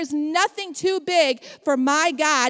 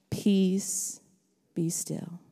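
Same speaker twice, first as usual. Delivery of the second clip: softer, calm and still; calm command; 5 semitones lower, 8 dB quieter, much slower than usual. Acted. Pastor voicing Jesus to the sea, soft calm command. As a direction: speak it like calming a storm.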